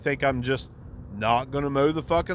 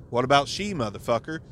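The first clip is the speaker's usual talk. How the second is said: ordinary talk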